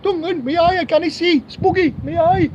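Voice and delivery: very high, hammed-up Geordie voice; mock a Newcastle Geordie badly, squeaky and high; bad Geordie accent. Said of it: "Sorry, really bad at accents".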